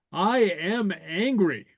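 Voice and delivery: "I am angry" drawn out, each word stressed; emphatic. Shown as a demonstration. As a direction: stretch every word of "I am angry" with a rise and fall. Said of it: "the emphatic".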